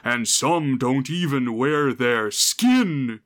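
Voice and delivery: put-on slow, eerie old storyteller; creepy old man voice. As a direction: lean into a creepy old man's warning. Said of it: "he says".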